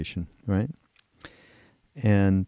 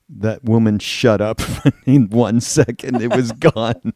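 ordinary talk, nothing marked

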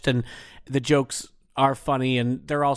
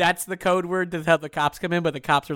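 ordinary talk

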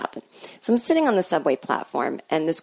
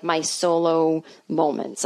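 ordinary talk

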